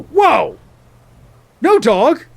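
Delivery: a mock panic cry, then slow lost questions; hammed-up dismay. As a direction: play a stunned dog owner, over the top. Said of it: "I'd go".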